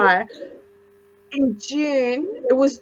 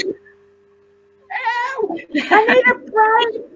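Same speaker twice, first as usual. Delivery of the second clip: much higher, whiny put-upon voice; worn-out wife melting down at her husband, higher and whiny; retold meltdown; 11 semitones higher, much slower than usual. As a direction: whine it up high like a fed-up wife. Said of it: "I lost it".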